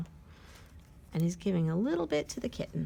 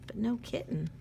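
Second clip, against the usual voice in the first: usual speaking voice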